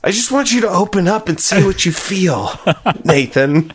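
mock therapist voice.

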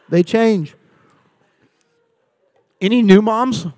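ordinary talk, nothing marked

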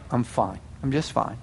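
low, very soft mock sulk; passive-aggressive. Spouse insisting nothing is wrong, passive-aggressive. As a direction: withdraw quietly while denying any problem. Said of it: "demonstrate passive-aggressive behavior".

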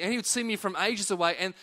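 ordinary delivery

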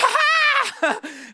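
high loud laugh; burst of laughter. Real, not acted. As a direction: laugh out at the memory. Laughing at scare story.

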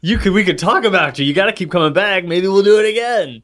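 mock-eager promo voice; imitating an eager host. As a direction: coax the listener back playfully. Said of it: "I'm like".

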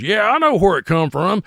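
high, loud imitated barfly; old drunk man. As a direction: butt in loudly as an old drunk. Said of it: "This old drunk man shooting pool".

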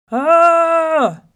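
high, very loud sustained yell; hollered roar. A narrator's reading. Enraged lion hollers into the well, roaring yell. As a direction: bellow with everything down a well. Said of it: "looked into the well again and hollered".

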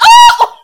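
high, loud startled shriek "Oh!"; sudden high shriek of alarm. Retold or imitated. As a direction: shriek in shock. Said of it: "I shrieked".

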